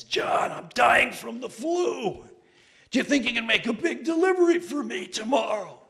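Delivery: high strained sickly pleading; sick friend on phone. Retold or imitated. Friend calling late at night, sickly high whine. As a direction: whine like you're dying of flu.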